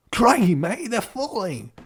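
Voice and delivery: high, panicked cry in another voice; stressed out. Acted. Kathy watching friends fall, stressed and high. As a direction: shout in alarm at the falling friends. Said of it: "says while stressing out".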